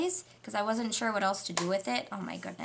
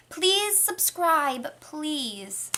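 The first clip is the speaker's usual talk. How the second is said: pleading; 7 semitones higher, 3 dB louder, much slower than usual. Real, not acted.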